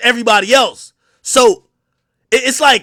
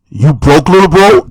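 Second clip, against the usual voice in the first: Shaq's voice, deeper and loud; imitating Shaq; 7 semitones lower, 8 dB louder than usual. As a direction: Shaq greets the rapper by calling him broke, deep and loud. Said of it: "First thing he said to me".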